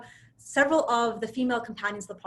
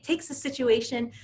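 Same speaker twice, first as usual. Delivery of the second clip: normal talking voice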